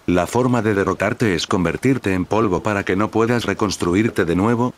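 ordinary delivery, nothing marked

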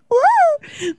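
high, loud delighted woo; excited whoop. Real, not acted. Showing the audience her legend of industry photo, whooping. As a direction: cheer it out. Cheering at her own slide.

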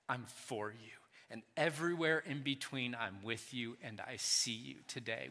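put-on God speaking softly to his child; tender parent. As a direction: reassure them like a loving parent. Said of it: "the love of a parent who says".

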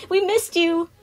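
put-on high, loud little-kid greeting; excited child. A narrator's reading. Children paddling up to Sun, excited greeting. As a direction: gush like a happy child.

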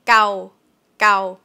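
gao clipped short. Shown as a demonstration. Teacher modelling short gao, scratch. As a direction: keep gao brief. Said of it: "Short version".

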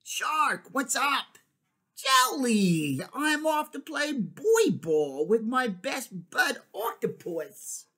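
high, bright cartoon voice for the shark and jelly; chirpy kid's-book friends calling out; cheerful sea friends. Picture book character voices.

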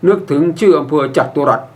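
ordinary delivery

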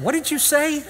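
high-pitched incredulous question. Retold or imitated. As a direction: play up stunned disbelief in the retelling. Acts out disbelief at silly excuse.

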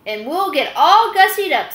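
giddy gussied-up chorus; excited hens. Acted. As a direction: squeal it like thrilled hens. Hens excited about dressing up.

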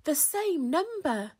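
high cry of discovery; excited shout. A narrator's reading. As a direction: exclaim it as the penny drops. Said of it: "shouted Spinderella".

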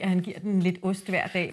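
plain reading voice

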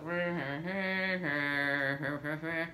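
soft, low wordless grumble; mock a grouchy server's reply; imitated sullen mumbling; grumbling server. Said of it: "He's like".

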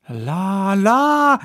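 a maid's startled high exclamation; crying chambermaid. Acted. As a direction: chambermaid squealing in alarm. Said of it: "she cried".

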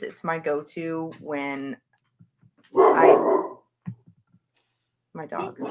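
normal talking voice

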